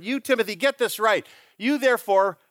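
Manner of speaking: "You" pushed up high, urgent address; single out "You" each time; emphatic you. Shown as a demonstration. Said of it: "It's an emphatic you".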